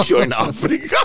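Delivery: laughing high and loud. Real, not acted. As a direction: laugh out the punchline. Punchline through laughter.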